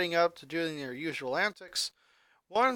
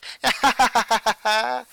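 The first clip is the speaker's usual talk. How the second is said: high, louder giggling laugh; loud chuckle; 5 semitones higher, 9 dB louder than usual. A narrator's reading. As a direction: laugh at your own idea. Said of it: "The fourteen-year-old chuckled".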